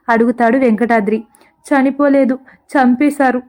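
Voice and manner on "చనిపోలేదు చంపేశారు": ordinary delivery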